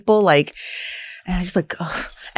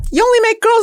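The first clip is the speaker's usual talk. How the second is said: very high, louder incredulous question; mock disbelief; 4 dB louder, much faster than usual. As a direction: act out your shock at him. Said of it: "I'm like".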